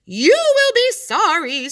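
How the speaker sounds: high, loud and slow threat; furious scream. Acted. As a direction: shark screaming his loudest. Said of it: "screamed the furious magic shark in his loudest voice".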